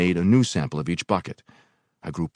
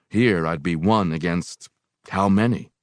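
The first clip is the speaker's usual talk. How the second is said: plain reading voice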